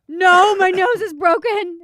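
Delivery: slow, high, very loud cry; overdramatic wail. Retold or imitated. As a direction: retell a hurt kid's outburst, hammed-up. Retold childhood outburst at mother.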